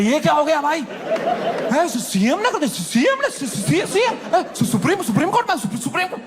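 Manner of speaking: high, stuttering panic; panicked stammer. Retold or imitated. Friend panicking over the show, stammering high. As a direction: trip over every word in fear. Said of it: "उनकी गांड फट गई".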